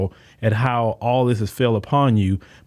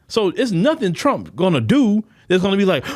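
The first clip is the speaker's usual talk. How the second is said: normal talking voice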